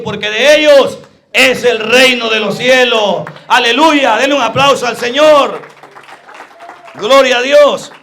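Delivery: loud, high climactic preaching; fiery revival crescendo; preaching at full force. Acted. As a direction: build the congregation up to applause.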